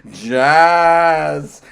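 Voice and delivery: a loud, teasing sing-song call of jazz; taunting call. Pretend. Taunting someone who dislikes jazz with a loud teasing call. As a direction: taunt them with the word.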